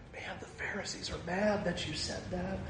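hushed warning. Retold or imitated. Disciples quietly warning Jesus.

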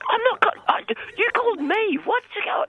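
high, indignant protest; narky. Acted. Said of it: "don't get narky at me".

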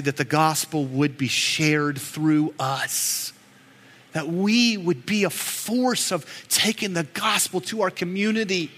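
usual speaking voice

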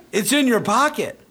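loud, very high incredulous cry; exasperated retold yell. Trainer to handler over the pocketed ball, exasperated. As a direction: burst out in high-pitched disbelief.

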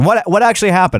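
ordinary delivery

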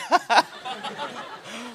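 soft imitated chuckle; imitated laugh. Mother-in-law laughing at the crying fiancé, imitated chuckle. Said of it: "her mother was just laughing like".

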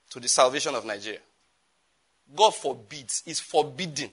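normal talking voice

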